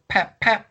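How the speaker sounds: sharp high pap pap again; high window smash sound. Retold or imitated. Imitating the second window break, sharp pap pap. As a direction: mimic a tool smashing glass.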